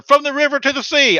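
mock protest slogan, pitched high; mimicked protester. Mimicking college kids' protest slogan.

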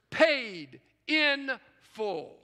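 very slow, each word set apart; deliberate slow proclamation; slow emphasis. Real, not acted. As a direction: drive it home word by word. Preacher's climactic three words.

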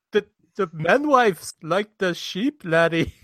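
hammed-up Scottish laddie voice; Scottish accent. Shown as a demonstration. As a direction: fumble through a comic Scots brogue. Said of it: "my Scottish accent".

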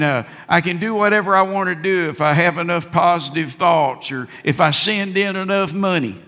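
ordinary talk

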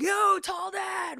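high, loud, drawn-out shout; big excited call-out to someone passing; imitated street holler. Imitating people calling out in street.

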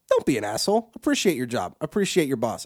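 usual speaking voice

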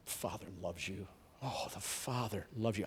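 soft, low, hushed and loving; prophesying love over a grieving young man, hushed; tender prophecy. Retold or imitated. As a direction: murmur it gently over him. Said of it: "And I kept saying".

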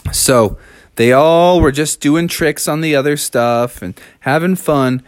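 usual speaking voice

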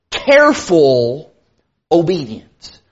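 "careful" drawn out slowly and set apart, low-pitched; stress on careful. Real, not acted. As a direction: lean slowly on "careful", pause before obedience. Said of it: "put a lot of emphasis on that word".

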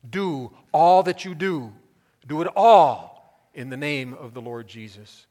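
"all" punched loud, then drawn back; stress on "all". Shown as a demonstration. Preacher driving home the verse to listeners, heavy stress on "all". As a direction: hit each "all" hard and loud. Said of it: "An emphasis on the word all".

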